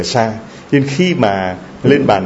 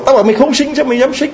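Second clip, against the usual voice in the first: very high-pitched retold berating; angry scolding; 12 semitones higher, 3 dB louder than usual. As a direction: berate her in a sharply raised pitch, acting out the doctor. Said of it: "đến chửi".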